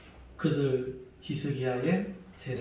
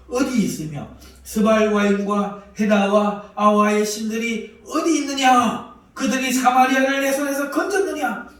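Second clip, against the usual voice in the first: much higher and louder; loud scornful taunt; 6 semitones higher, 9 dB louder than usual. Acted. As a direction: jeer at the fallen gods. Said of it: "삼십삼 절에 조롱합니다".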